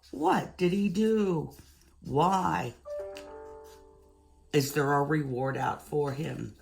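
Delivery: ordinary delivery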